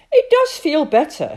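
pleasantly taken aback; mildly surprised. Acted. Father finds his back no longer hurts, mildly surprised. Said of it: "he said in a tone of surprise".

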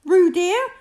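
high, loud worried calling; anxious call. A narrator's reading. Kanga checks on Roo in the water, anxious call. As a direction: call out anxiously to your child. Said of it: "called Kanga anxiously".

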